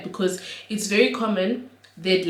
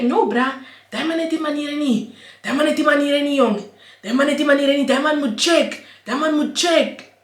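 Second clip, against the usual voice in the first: heated retort; 7 semitones higher, 4 dB louder than usual. Retold or imitated. Retold heated reply.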